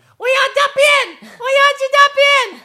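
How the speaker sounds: put-on very high, loud child's yell; little kid whining. Host imitating little kids needing the toilet, loud high yelling. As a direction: yell it like an insistent small child. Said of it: "like little kids, they just".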